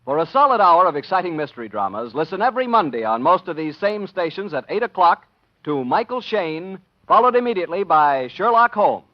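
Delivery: formal radio sign-off; announcer credits. Acted. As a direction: read the closing credits over music.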